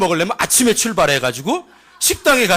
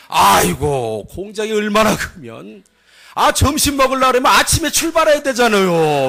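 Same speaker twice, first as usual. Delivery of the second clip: boastful cousin. Retold or imitated. Cousin exaggerates the factory's size to villagers, animated imitation. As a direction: marvel at it like a rural tale-teller. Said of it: "외사촌 형님이 삼성 다녀와서 했던 얘기".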